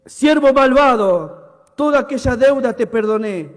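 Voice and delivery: a king scolding his servant; angry rebuke. Acted. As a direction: thunder it down on the wicked servant. Master rebuking wicked servant.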